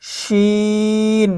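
শিন with a long held ee; three-alif stretch. Shown as a demonstration. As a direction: draw out the vowel of শিন evenly for three alifs. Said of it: "তিন আলিফ টান হবে". (শিন তিন আলিফ টেনে)

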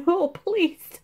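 high mock plea, half-laughing; mock dread. To the sick family, mock pleading dread. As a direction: beg not to catch it. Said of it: "me and Rebecca were like".

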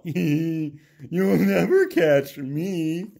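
slow, laughing, teasing donkey voice; donkey mocks the farmer as it escapes, laughing; laughing donkey taunt. A narrator's reading. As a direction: taunt them through a laugh. Donkey taunts while running.

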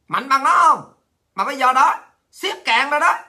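loud, high, furious jeering; angry taunting shout. Real, not acted. Shouting down the Party's propagandists, furious and loud. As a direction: yell the challenge at them with scorn.

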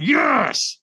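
high, strained push of effort; stronger yes. Retold or imitated. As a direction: force it out harder, pitched well up. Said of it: "And he went, "Stronger".

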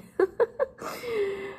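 helpless little laugh; giggle. Real, not acted. Teacher amused by Joe's speech, giggling.

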